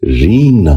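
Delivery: رینگنا said "reengna", long "ee" and softened g; correct pronunciation. Shown as a demonstration. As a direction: open رینگنا with a drawn "reeng". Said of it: "ذہن نشین کر لیجئے".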